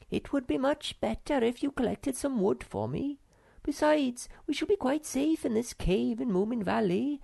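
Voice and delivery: a sister waving the worry away; Snork Maiden voice. Acted.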